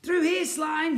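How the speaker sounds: high, loud call. A narrator's reading. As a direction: call out loudly to a companion. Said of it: "he called out".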